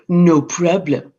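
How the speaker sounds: slow, low, smug reply; smug husband brushing it off. Acted. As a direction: drawl it out, full of himself. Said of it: "He said".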